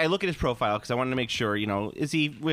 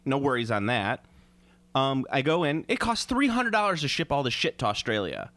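normal talking voice

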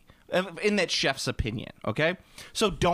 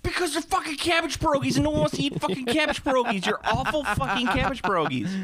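heated rant; 9 semitones higher than usual. Real, not acted. Ranting about family food.

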